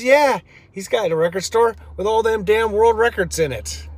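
high-pitched mock East Coast accent; hammed-up East Coast accent for a punchline; Ray Korlitz's accent. East Coast runner landing his tall-tale joke, in his typical accent. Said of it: "his typical accent, he says".

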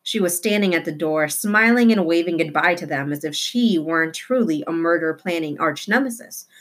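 ordinary delivery

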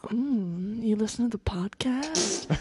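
slow breathy whisper; sexy voice. Pretend. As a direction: whisper it seductively, slowly. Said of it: "what if I do my sexy voice".

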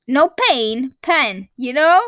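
"pain" then "pen", loud and drawn out; correcting pen vs pain. Shown as a demonstration. Explaining to viewers how to say the name of the product, drawn-out correction. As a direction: set "pen" apart from "pain", slowly and loudly. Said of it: "it's called pen remover".

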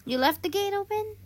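high, disbelieving question; can't believe she left it open; surprised. Real, not acted. Friend hears the nightmare story, surprised high question.

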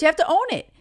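ordinary talk

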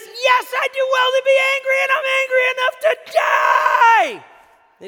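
very high, very loud furious yell, slowing at the end; Jonah's angry shout. Retold or imitated. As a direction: shout it back at God in full fury. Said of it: "But he says".

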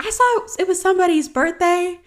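high, put-on singer's voice; imitating the star. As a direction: play a star teasingly announcing a birthday. Voices [name] returning to her.

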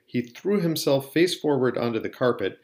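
ordinary delivery, nothing marked

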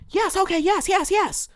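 very high, squeaky mock of a yes-man; mocking sheep voice. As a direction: sneer at the blind followers in a silly high voice. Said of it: "You're saying yes to everything they say".

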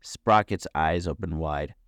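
usual speaking voice

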